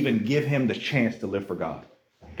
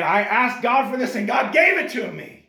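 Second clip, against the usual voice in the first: high, joyful mother's voice; grateful Hannah; 10 semitones higher, 4 dB louder than usual. Retold or imitated. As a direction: thank God as an overjoyed mother.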